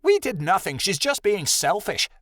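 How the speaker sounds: snorting scorn. A narrator's reading. Rebecca dismisses her missing friend as selfish, snorting scorn. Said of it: "Rebecca snorted loudly".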